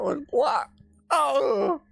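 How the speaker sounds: very high squeal of disbelief; shocked shriek. Real, not acted. Shrieking at weird trailer.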